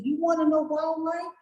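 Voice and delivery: high, pointed rhetorical challenge; challenging question. Retold or imitated. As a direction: throw the question back at her. Challenging the woman about wildlife.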